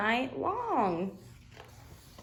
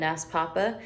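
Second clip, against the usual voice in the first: ordinary delivery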